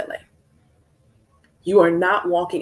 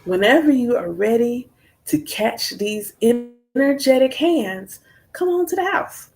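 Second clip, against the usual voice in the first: high, taunting come-at-me dare; sassy challenge; 6 semitones higher than usual. Retold or imitated. Daring a man to come cry at the house, high and taunting. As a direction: tease him to come and get it. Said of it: "I'm like".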